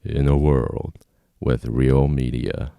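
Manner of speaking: slow, loud movie trailer announcer. Shown as a demonstration. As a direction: announce it slowly like a film trailer, dropping low at the end. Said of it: "you have to do the movie voice".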